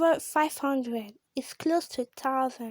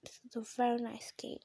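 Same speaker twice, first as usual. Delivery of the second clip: softer, whispered praise; whispered aside; 9 dB quieter, faster than usual. Real, not acted. Gamer whispering an aside to viewers, hushed. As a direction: confide it quietly.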